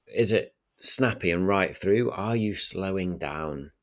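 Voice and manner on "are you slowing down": normal talking voice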